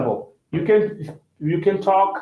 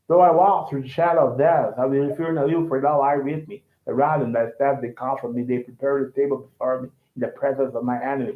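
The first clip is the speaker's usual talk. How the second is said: American scripture reading; 4 semitones lower, 3 dB quieter, faster than usual. Shown as a demonstration. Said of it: "when you listen to an American".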